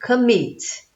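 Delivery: "commit" drawn out, stressed on "mit"; stress on final syllable. Shown as a demonstration. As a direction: lean slowly on the "mit" of "commit". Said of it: "terminou em sílaba tônica". ("commit" com ênfase na sílaba final "mit")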